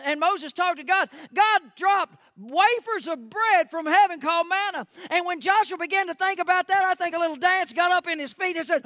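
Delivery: high, loud, building preaching; full-force preaching. Real, not acted. Preacher retelling the manna miracle, full-force preaching. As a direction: drive the story up toward a peak.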